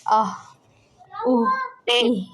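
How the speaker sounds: آہ اوہ ای voiced from the back of the throat; throat vowel demo. Shown as a demonstration. Teacher showing how sukoon makes the sound clear. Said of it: "جتنا اس کو ساکن کر کے پتہ چلے گا".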